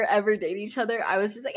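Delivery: ordinary talk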